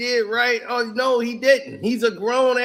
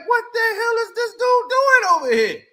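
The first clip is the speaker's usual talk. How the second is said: shocked coach; 9 semitones higher, 5 dB louder than usual. Retold or imitated. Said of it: "Spurs coach is looking like".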